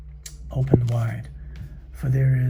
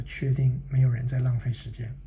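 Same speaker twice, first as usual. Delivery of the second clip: ordinary delivery, nothing marked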